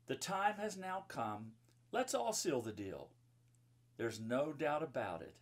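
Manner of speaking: bright, sing-song rhyming read-aloud; lilting storybook verse; reading to children. Acted. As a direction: read the rhyme to a room of kids. Reads rhyming children's book.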